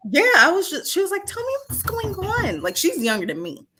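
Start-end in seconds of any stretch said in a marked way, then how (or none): 1.3-2.6 high-pitched mock therapist voice; imitating a therapist. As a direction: coax in a put-on high voice. Voicing the young therapist's probing.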